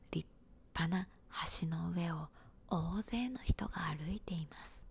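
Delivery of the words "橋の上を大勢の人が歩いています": ordinary delivery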